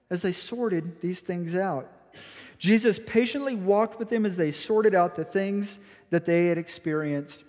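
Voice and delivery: ordinary delivery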